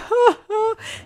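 high, loud put-on laugh; hammed-up high hoots of laughter; mock laughter. Mock laughter after the squeal. (risa fingida, aguda y exagerada)

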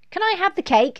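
put-on high, loud little boy; demanding child. A narrator's reading. Lester pesters Mum for cake, high and loud. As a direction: pester eagerly, pitched high. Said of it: "asked Lester".

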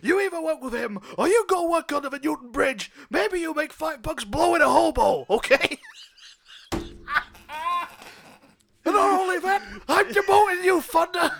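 loud, high stressed captain ranting; exasperated captain. Acted. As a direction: rant at the cop who costs you. Captain yells at cop.

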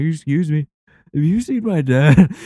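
high, loud worried asking; a lost kid begging strangers for help; anxious kid. Retold or imitated. Kid asks strangers on the mountain, high and anxious. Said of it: "going up to random people".

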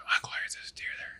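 fast hushed whisper; waking his daughter quietly, whispering. Retold or imitated. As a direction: whisper quickly so the deer won't hear.

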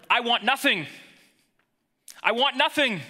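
high, loud, ringing proclamation; emphatic declaration. Shown as a demonstration. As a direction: proclaim it with full conviction. Emphatic Hebrew rendering, repeated.

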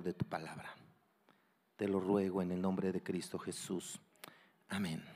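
low, soft, reverent prayer; hushed petition to God with long pauses. Real, not acted. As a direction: pray quietly in short, paused phrases. Opening prayer before the message.

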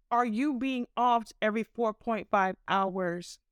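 ordinary delivery

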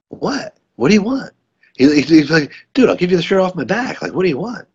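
high, squeaky imitation of startled disbelief; mock surprise. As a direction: jump up high, pleading with the robbers. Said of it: "Alfred Molina's like".

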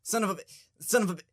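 high-pitched stuttering imitation; stuttering Porky Pig. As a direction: get stuck and restart in quick, high bursts. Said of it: "he was stuttering".

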